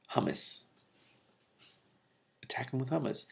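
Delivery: soft deadpan aside; whispered joke. Real, not acted. Reader jokes to herself about hummus, hushed. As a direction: slip in the joke under your breath. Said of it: "reader's joke aside".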